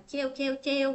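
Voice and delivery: "treo" for twelve, one syllable with tr; common learner mispronunciation. Retold or imitated. Teacher mimicking learners' mistaken twelve, mispronounced as "treo". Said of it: "rất nhiều bạn cũng không có nói được".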